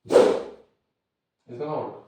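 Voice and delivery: forced out slowly and loudly on held breath; strained breath-held speech. Shown as a demonstration. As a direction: push the words out without breathing. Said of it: "if you do this and just normally don't breathe".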